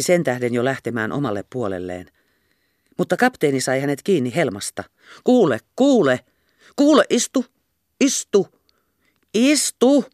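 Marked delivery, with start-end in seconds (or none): 5.2-10.0 urgent pleading. Acted. Captain grabbing Kerttu's hem.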